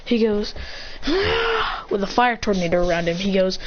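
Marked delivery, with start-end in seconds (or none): 0.6-1.9 high, drawn-out straining cry; mock power-up scream. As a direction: strain out a power-up yell at contained volume. Powering up in fire tornado.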